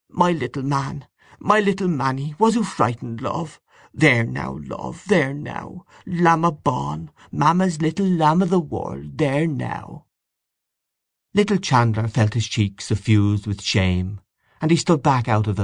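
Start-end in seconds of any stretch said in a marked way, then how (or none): 0.1-10.1 put-on rock the baby with your voice; murmuring.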